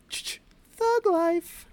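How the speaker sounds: high, slow put-on voice; catchphrase imitation.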